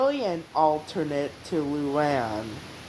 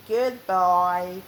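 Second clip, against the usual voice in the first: usual speaking voice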